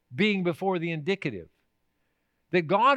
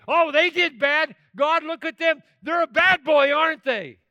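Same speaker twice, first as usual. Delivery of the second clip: mock tattling; 9 semitones higher, 7 dB louder than usual. Churchgoer tattles to God about others, smug and loud. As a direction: point a finger at them like a smug child. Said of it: "we say".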